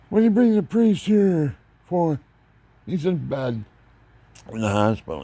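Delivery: high, loud, indignant outburst; a touch of a sharp, loud protest; indignant uncle. Retold or imitated. Uncle objects to the priest.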